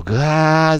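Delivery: mock guitar crunch with the mouth; imitated guitar distortion. Shown as a demonstration. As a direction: growl out the distorted guitar noise. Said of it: "歪んでいるんだけど".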